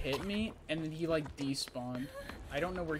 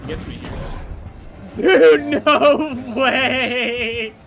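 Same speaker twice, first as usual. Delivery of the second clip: dawning joy; 4 semitones higher, 9 dB louder, slower than usual. Real, not acted. Friends gaming, unbelievable enemy blunder.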